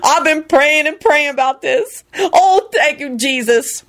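high, slow, tearful thanks; blubbering. Acted. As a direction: weep with relief.

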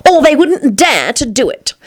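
ordinary delivery

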